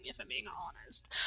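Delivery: a secret half-hidden behind the hand; whispered aside. Retold or imitated. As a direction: drop to a whisper to confide. Confiding aside in a quote.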